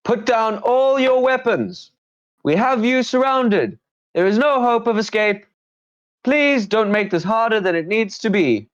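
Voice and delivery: high-pitched bandit shouting to stop travellers; bandit yelling a halt. Acted. Highwayman stops the party on the trail, high loud yell. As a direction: yell out as a squeaky highwayman blocking the pass. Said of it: "the voice yells out again".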